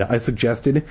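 usual speaking voice

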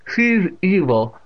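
put-on loud, high, slow old woman; deaf old aunt, amused. A narrator's reading. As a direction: relish it loudly and slowly.

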